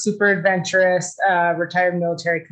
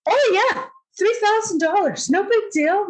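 fake casual; 12 semitones higher than usual. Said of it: "And I'm like".

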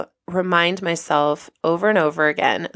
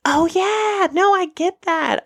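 much higher, sunny and upbeat reply; bright, friendly agent; 12 semitones higher than usual. Retold or imitated. TSA agent cheerfully reassures a stressed traveler, bright and high. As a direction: chirp it like a cheerful security agent. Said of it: "bright, shining light in this moment".

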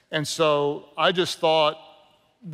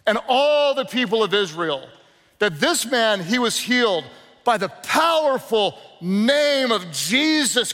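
slow, raised, proclaiming Peter; Peter's bold declaration; 4 semitones higher, slower than usual. Retold or imitated. Peter declares boldly to the council, slow and raised. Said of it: "he said".

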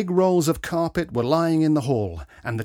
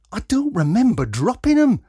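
protesting; 10 semitones higher, 6 dB louder than usual. A narrator's reading. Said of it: "Robbie protested".

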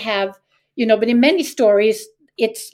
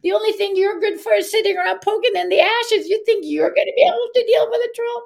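teasing; 10 semitones higher than usual. Acted. Brothers jeering at the Ash lad, mocking. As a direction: taunt him in a high voice. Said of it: "they tease him".

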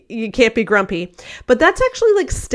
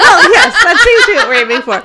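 loud, quick, laughing agreement; real laugh while agreeing; 8 semitones higher, 10 dB louder, faster than usual. Real, not acted. Laughing at the sarcastic answer.

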